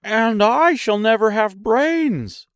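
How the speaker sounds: high, loud, slow character voice; high-pitched straw man's lament; lamenting Scarecrow. A narrator's reading. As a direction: wail it high and drawn out.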